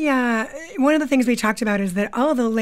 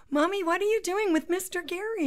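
put-on high, soft child's voice; five-year-old twins; 6 semitones higher, 7 dB quieter than usual. Twins walk in on mother's rehearsal, small child voice. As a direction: ask like a puzzled little kid. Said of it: "my twins would walk in and say".

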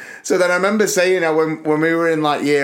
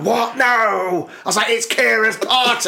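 mock teen protest; 7 semitones higher than usual. Grounded teenager to dad about a party, mock whining. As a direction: wail in hammed-up teenage outrage.

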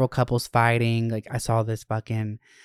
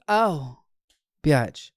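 usual speaking voice

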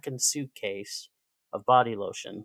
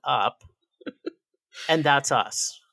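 exasperated retort; 6 semitones higher, 7 dB louder than usual. Retold or imitated.

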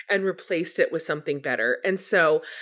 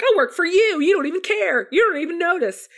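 furious at God; 11 semitones higher, 5 dB louder than usual. Retold or imitated. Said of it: "just absolutely furious at God".

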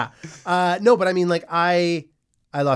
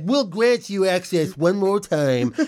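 slow, grand pardon voice; mock solemn guild leader; 4 semitones higher, much slower than usual. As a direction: grant it slowly like a pompous official. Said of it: "They're like".

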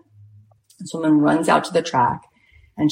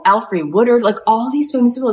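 ordinary talk

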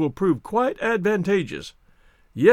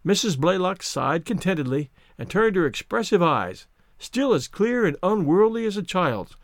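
plain reading voice

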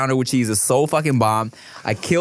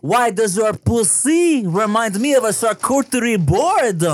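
put-on French accent; 7 semitones higher than usual. Playing snooty French food lover.

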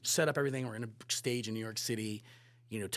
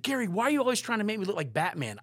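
higher, louder grumbling question; mock complaint; 10 semitones higher, 7 dB louder than usual. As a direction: tease Gary as a whining Tom. Tom complaining.

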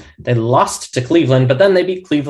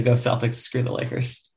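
hushed aside; 2 semitones lower, 8 dB quieter than usual. Pretend. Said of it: "we can say".

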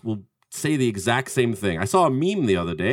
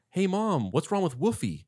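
lightly imitated boy, pitched up and soft; John Connor; 5 semitones higher, 6 dB quieter than usual. Terminator posing as a boy calling mom, higher mimicked voice. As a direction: put on a kid's higher phone voice. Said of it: "in John Connor's voice. He's doing his voice".

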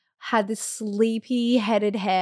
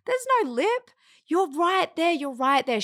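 higher-pitched, reassuring midwife voice; bright, higher reassurance from the midwife at the pool; 8 semitones higher than usual. Retold or imitated. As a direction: lift the voice up high and soothe her, she's nearly there. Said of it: "she was like".

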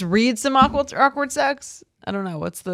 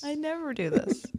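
mocking echo; 7 semitones higher, 11 dB quieter than usual.

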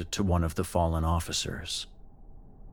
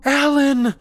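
high screamed name; very loud, high-pitched yell; calling at top of lungs; 10 dB louder than usual. A narrator's reading. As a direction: scream out to a friend across the din. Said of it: "Paige called at the top of her lungs".

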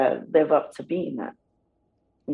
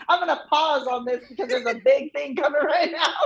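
high-pitched, laughing mock announcement; laughing imitation; 10 semitones higher than usual. Niece quotes uncle pausing for a plane, laughing. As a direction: crack up while quoting the promo cutter.

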